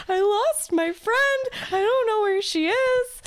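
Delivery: slow, high, hammed-up teary plea; retold sobbing. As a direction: imitate herself crying drunk on the grass. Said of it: "like, just sobbing".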